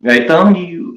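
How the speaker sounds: high, creeping menace closing in; creepy stalker voice. Retold or imitated. Mocking Tonic as he creeps up close on the streamer. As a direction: taunt like a horror villain sneaking up. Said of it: "and then Tonic, like".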